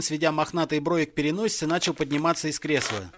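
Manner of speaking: ordinary delivery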